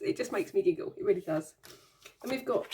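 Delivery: ordinary talk, nothing marked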